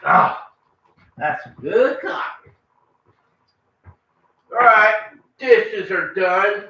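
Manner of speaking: usual speaking voice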